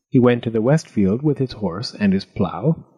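ordinary delivery, nothing marked

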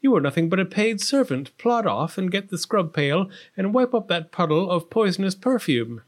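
spoiled princess talking down to a servant; peevish. A narrator's reading. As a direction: order the maid about crossly. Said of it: "replied Prunella peevishly".

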